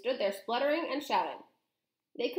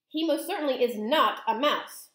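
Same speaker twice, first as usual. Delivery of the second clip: loud, higher indignant denial; shouted; 4 semitones higher, 5 dB louder than usual. A narrator's reading. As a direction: firmly shout her down. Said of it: "shouted Mister Jenkins".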